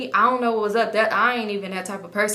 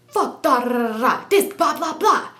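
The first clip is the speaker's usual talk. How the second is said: higher, punchy gibberish taunt; mock gang banging; 4 semitones higher than usual. Man banging on a rival girl, mock aggressive. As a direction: spit out nonsense syllables like a challenge. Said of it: "He banged on this girl".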